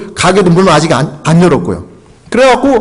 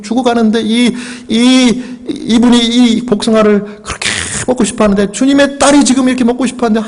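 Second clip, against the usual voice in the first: higher-pitched, pleading prayer climbing steadily; fervent prayer; 4 semitones higher than usual. Retold or imitated. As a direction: plead with God, pitch rising as the plea builds. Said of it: "눈을 뜨고 기도를 했습니다".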